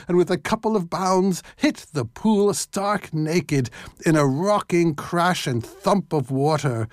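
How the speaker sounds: ordinary delivery, nothing marked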